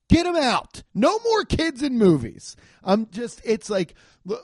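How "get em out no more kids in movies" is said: higher, louder fed-up outburst; exasperated yell against a movie trope; fed-up rant. Real, not acted. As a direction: shout it like you're done with it. Ranting against kids in movies.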